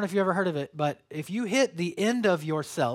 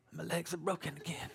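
much softer, hollow resignation; defeated murmur; 11 dB quieter than usual. Retold or imitated. Runner after bonking again, softly defeated. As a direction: mutter it as if all hope drained out. Retold collapse in race.

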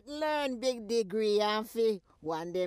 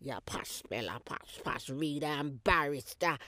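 mocking posh; 6 semitones lower than usual. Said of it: "I come back posh".

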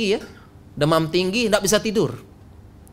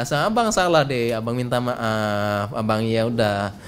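coaxing husband; 7 semitones lower than usual. Acted. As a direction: play a husband soothing his sulking wife. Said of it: "Suami yang bujuk kan?".

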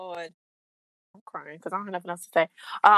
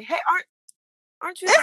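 excited fan voice; 12 semitones higher, 4 dB louder, faster than usual. Retold or imitated. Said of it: "people stop me and say".

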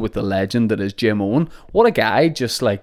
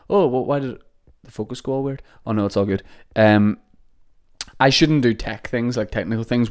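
ordinary talk

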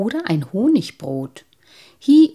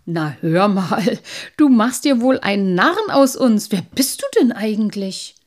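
put-on louder, indignant boy voice; suspicious boys; 2 semitones higher, 8 dB louder than usual. A narrator's reading. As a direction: accuse him of kidding. Boys suspect mockery.

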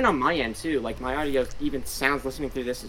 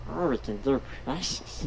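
Gollum voice. Retold or imitated. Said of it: "I can still do that voice".

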